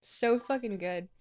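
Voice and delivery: ordinary delivery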